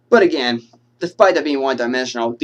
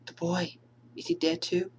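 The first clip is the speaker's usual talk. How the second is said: softer, higher dazed question; Alice; 6 semitones higher, 11 dB quieter than usual. Acted. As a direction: fragile hushed question. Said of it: "from Alice at the end of the movie".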